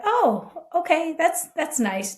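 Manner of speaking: high, mock-polite chirp; forced politeness. To the oversharing golfer, a high mock-polite reply. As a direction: fake brightness to hide discomfort.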